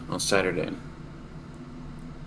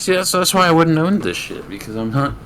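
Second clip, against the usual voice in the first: flustered laughing exclaim; 8 semitones higher, 9 dB louder than usual. Real, not acted. Right after dropping the stone.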